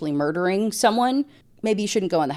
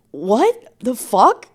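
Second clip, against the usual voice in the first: mock stunned; 4 semitones higher, 5 dB louder, much slower than usual. Boyfriend stunned.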